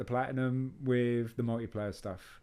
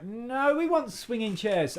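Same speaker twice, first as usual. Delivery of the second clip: high mock voice; 9 semitones higher, slower than usual. Mock quoting what he tells the stores.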